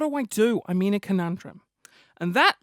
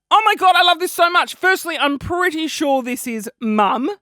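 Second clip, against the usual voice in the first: loud, high burst of delight; gushing; 7 semitones higher, 7 dB louder than usual. Retold or imitated. Comedian reading his reply to a fan, gushing.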